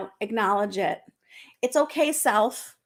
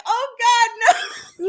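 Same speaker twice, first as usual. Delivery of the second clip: high, loud, hammed-up wail; mock anguish; 10 semitones higher, 5 dB louder than usual. Retelling her panic over a covid test, mock crying. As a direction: cry out in mock despair. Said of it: "I'm like".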